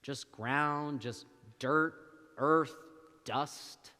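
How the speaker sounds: normal talking voice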